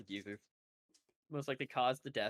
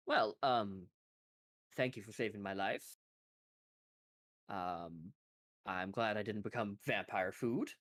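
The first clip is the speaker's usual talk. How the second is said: lightly voiced, lower, hesitant thanks; rescued man, awkwardly grateful; 6 semitones lower than usual. Rescued man thanking the party for his life, awkward low voice. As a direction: thank them haltingly.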